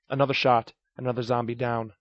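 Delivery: usual speaking voice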